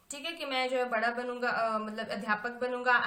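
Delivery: ordinary delivery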